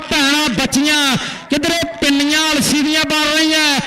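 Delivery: loud stadium play-by-play; live commentary. Acted. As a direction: announce it to the whole ground. (ਜੋਸ਼ੀਲੀ ਕੁਮੈਂਟਰੀ)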